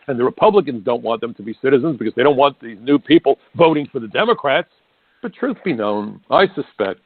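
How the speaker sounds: ordinary talk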